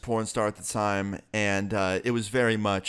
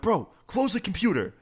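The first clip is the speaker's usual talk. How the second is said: urgent plea; 9 semitones higher than usual. Retold or imitated. Urging friend during embarrassing moment.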